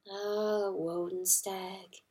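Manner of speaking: soft, slow murmur. A narrator's reading. Hen murmuring to the wise mouse, soft and slow. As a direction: murmur his name tenderly. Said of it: "murmured Pertolote".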